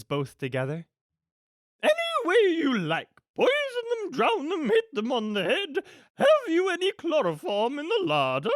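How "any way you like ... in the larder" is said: high, swooping grande-dame villain voice; loud, theatrical and callous schemer; imperious villainess. Acted. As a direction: play a haughty villainess, pitched way up. Acts the villainess Cruella.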